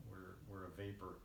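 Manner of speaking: ordinary delivery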